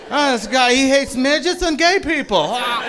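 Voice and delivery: high, loud, slow whiny outrage; mock offended blogger. Comic imitating an offended Seattle blogger, whiny. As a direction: play the scandalized blogger.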